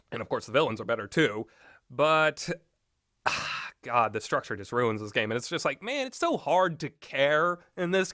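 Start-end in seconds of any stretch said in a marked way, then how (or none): none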